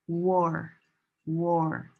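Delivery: "War" said alone with a clear W; W syllable. Shown as a demonstration. Teacher isolating the W sound for students. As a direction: stress the W of "War". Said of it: "This W helps divide the syllables".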